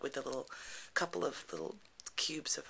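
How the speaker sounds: ordinary talk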